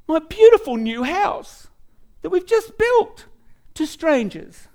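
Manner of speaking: high, slow pleading prayer; earnest prayer. Retold or imitated. Alone on the front step, praying to be like [name], slow earnest pleading. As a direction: pray it out, lifting each plea to God. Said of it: "and I'm praying".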